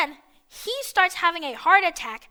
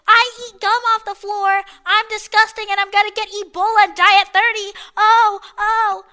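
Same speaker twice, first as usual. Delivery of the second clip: loud, high mocking boast; hammed-up dumb kid announcing himself; mock parading idiot; 5 semitones higher, 8 dB louder than usual. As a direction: parade it round like a proud fool. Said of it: "just parading around the school like".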